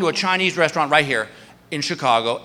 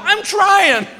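sheepish excuse; 12 semitones higher, 3 dB louder than usual. Retold or imitated. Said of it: "I go".

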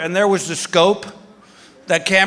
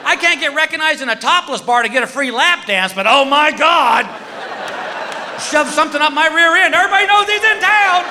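mock outrage; 11 semitones higher than usual. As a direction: squeal in comic disbelief. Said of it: "I'm like".

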